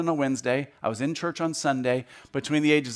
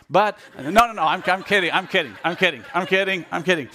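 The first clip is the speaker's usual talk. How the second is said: louder and higher, laughing through no, no, I'm kidding; 4 semitones higher, 6 dB louder than usual. Real, not acted. To the congregation after joking about his parents, laughing. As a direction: chuckle away the joke, higher pitched.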